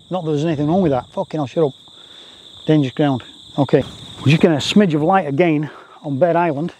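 ordinary delivery

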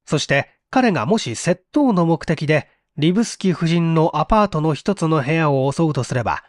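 ordinary delivery

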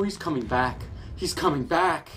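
usual speaking voice

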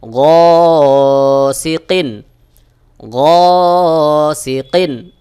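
"গসিকিন" stretched very slowly and loud; slow recitation. Shown as a demonstration. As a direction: draw out "গসিকিন" in chant. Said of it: "কফ দুই জের কিন". ("গসিকিন" টেনে তিলাওয়াত)

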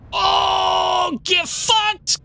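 triumphant shout. Real, not acted. Gamer cat pounces on bird, triumphant shout.

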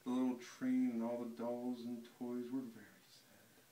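ordinary delivery, nothing marked

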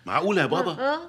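can't believe what dad did; incredulous reproach. Acted. Son reproaches father. (استنكار مندهش عالٍ)

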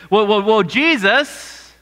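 astonished. Retold or imitated. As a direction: ask it stunned, reaching up. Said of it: "look at him in astonishment".

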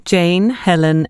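usual speaking voice

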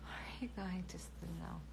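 very slow whisper. Real, not acted. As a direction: whisper it slowly. (아주 느리게 속삭이며)

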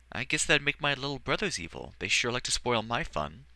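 put-on a shy boy, unsure how he feels; young boy voice. Edward complaining about brothers.

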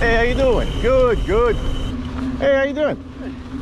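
hammy New York Italian hello to passing riders; Mister Bonelli greeting. Acted. Biker greets oncoming riders as Mister Bonelli, Italian-American and hammy. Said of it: "Like the ghost of Mister Bonelli is".

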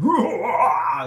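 a big bright laugh bursting out; loud burst of laughter. Real, not acted. Coach laughing at the Wizard of Oz picture, loud burst. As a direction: laugh hard at the little man behind the curtain.

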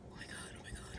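hushed, repeated gasps of disbelief; excited whisper. Real, not acted. Overwhelmed by first snow.

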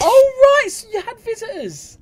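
very high squeal, dropping in volume; high-pitched reaction. Real, not acted. Reactor learns she had visitors, high-pitched squeal. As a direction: squeal it, starting loud then easing off.

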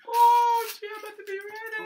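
very high, loud and fast squeal; mock excited falsetto; squeaky put-on voice. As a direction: squeal it out in a goofy cartoon pitch. Silly squeal while getting ready.

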